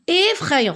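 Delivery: "Effrayant" with the opening E sounded as a clear é, pitched high; teacher sounding out the é that opens "Effrayant"; stressed first vowel. Shown as a demonstration. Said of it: "j'entends et".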